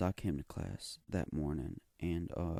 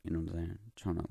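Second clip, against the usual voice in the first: ordinary talk, nothing marked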